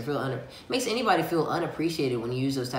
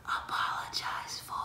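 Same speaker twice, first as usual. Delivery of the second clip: slow, hushed whisper; 10 dB quieter than usual. Acted. Inner voice nudging him to apologize, whispered. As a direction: drop right down to a little whisper. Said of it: "pay attention to that little whisper".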